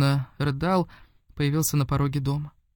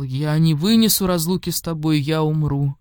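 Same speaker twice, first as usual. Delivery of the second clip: usual speaking voice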